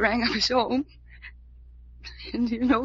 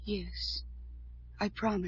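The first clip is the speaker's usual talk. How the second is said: hushed promise; 4 semitones lower, 13 dB quieter, slower than usual. Acted. Tearful quiet promise.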